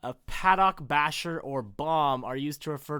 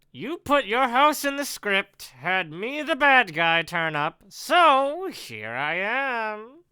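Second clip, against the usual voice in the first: taunting bad guy, drawn-out ending; louder, higher, smugly menacing intruder; villain Ken; 6 semitones higher, 7 dB louder, slower than usual. Villain confronts his writer in his own house, taunting. Reads villain Ken's dialogue.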